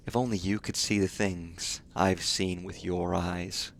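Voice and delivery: quiet, slow, soft film line; hushed movie quote. Retold or imitated. Streamer quoting a Blade Runner line to chat, hushed and slow. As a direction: hush it like a replicant's confession. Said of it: "a quote from Blade Runner".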